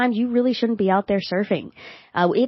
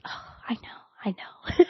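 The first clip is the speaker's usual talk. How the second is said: soft, patient whisper; soothing whisper; 6 dB quieter than usual. Retold or imitated. Partner humors his frustrated partner, patient soothing whisper. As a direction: whisper it back, humoring her. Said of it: "He just smiles at me and goes".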